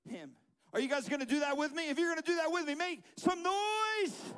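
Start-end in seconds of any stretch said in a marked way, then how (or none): 2.7-4.3 high, loud, long-drawn crowd call; rallying shout. Real, not acted. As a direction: whip the crowd into noise, slowly stretched. Rallies the crowd to cheer.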